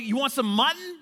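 high, loud, hearty invitation; eager offer. Retold or imitated. Rich guy offering his buddy a meal, eager and loud. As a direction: offer it like a party treat.

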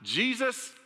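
high, loud cry across a crowd; calling out. Acted. Blind beggar calling out to Jesus, high loud cry. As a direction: shout for Jesus over the crowd. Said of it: "He called out".

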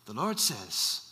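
low, soft attempt at Nigerian; badly imitated Nigerian accent, kept quiet; deep-pitched, soft-spoken Nigerian imitation; poor Nigerian accent. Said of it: "that's not a good Nigerian accent".

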